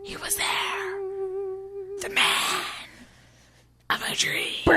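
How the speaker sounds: hushed, then rising high; dramatic whisper. Pretend. Retelling the cliffhanger to the co-host, mock dramatic whisper. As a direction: build the suspense from whisper to high.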